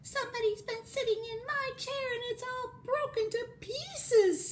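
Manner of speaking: squeaky, very high little sob; Baby Bear. Acted. Baby Bear with his chair broken, squeaky mock sobbing. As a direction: wail it in a tiny squeak. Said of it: "sobbed Baby Bear in a squeaky little voice".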